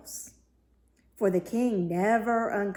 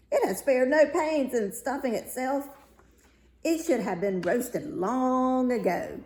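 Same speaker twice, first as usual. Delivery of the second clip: a hint of a bustling cook, higher and louder; brisk, approving kitchen cook; hearty cook; 4 semitones higher, 4 dB louder than usual. A narrator's reading. Cook judges the fat duck.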